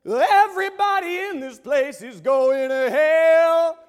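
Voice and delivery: loud, slow, high declaration; slow proclamation. Retold or imitated. Rock star telling his concert crowd, slow loud proclamation. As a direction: announce doom to the whole room. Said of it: "instead of singing the proper lyrics, he says".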